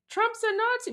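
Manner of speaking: high squeaky imitation of the protester; mock yelling protester. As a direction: parody of the protester's yell. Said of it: "starts yelling".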